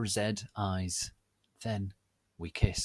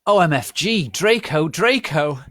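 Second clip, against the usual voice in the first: high, loud scream; shrieking the name; 8 semitones higher, 13 dB louder than usual. Acted. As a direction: scream it out at the top of the voice. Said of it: "I screamed".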